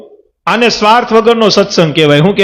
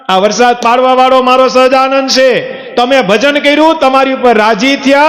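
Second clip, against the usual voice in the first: higher, forceful sermon voice; fervent preaching; 6 semitones higher than usual. Acted. Preacher urging his listeners to credit Shriji, higher and fervent. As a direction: preach it out with rising fervour.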